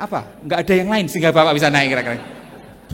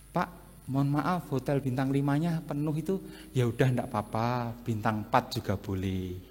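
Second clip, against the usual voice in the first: meek phlegmatic guest; 6 semitones lower, 9 dB quieter than usual. Acted. Phlegmatic guest accepting four-star hotel, soft and yielding. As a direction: gently let it go, no fuss. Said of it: "Orang plekmatis kayak gitu".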